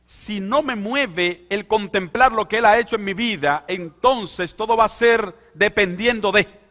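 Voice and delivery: high, loud fervent preaching; full-force preaching. Acted. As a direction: preach it out to the whole congregation. Preaching on giving.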